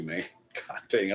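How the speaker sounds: ordinary talk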